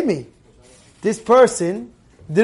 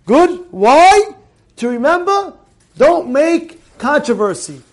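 high, emphatic sermon exhortation; urgent, raised-voice preaching; forceful preaching; 7 semitones higher, 5 dB louder than usual. Acted. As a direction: drive the lesson home to the congregation. Preaches the closing lesson.

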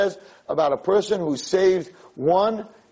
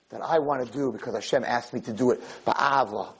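ordinary talk, nothing marked

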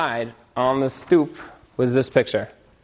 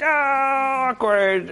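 high drawn "Oh, awkward"; the cringe of catching on; mock awkward realization; 11 semitones higher, much slower than usual. Ex-wife realizes the connection.

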